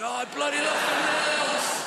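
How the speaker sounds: high mock craving gush; a mock fan gushing about lilt. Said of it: "You're like".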